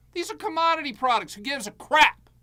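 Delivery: high, loud exasperation; exasperated rant. Real, not acted. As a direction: vent it loudly.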